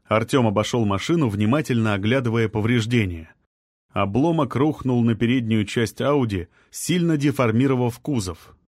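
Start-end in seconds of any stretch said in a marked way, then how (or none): none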